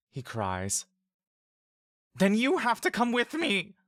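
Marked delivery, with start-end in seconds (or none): 2.2-3.8 high, desperate pleading shout; Mock begs the brother to flee too; pleading cry. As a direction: beg him to come along.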